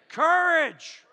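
booming, high single word; one-word preacher shout; shouted command. Acted. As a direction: bellow it at the congregation.

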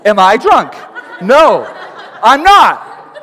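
loud, emphatic acted protest; indignant denial. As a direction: firmly deny a silly accusation. Acting the accused answering back.

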